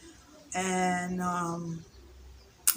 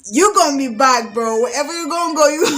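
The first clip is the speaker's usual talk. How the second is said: much louder, teasing threat; mock warning; 5 semitones higher, 11 dB louder than usual. Friends teasing that her ex will return, loud mock warning. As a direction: call it out like a playful curse. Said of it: "So they're like".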